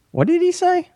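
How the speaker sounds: high, confused mock Canadian listener; puzzled Canadians. Guest mocking Canadians who can't follow him, high and puzzled. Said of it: "everybody in Canada will be like".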